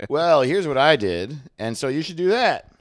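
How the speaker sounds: a light know-it-all comedian voice; smug comedian. Retold or imitated. Comedian advising a newcomer, lightly mocking smug. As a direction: lecture like a self-satisfied veteran. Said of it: "Every comedian is just like".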